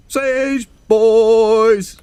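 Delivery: loud, high call to the fans; hyped shout-out. Real, not acted. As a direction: belt out the shout-out like a cheer.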